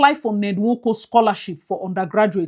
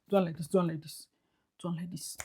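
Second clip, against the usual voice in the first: whisper; 5 semitones lower, 17 dB quieter, faster than usual. Pretend. Acting out the walk.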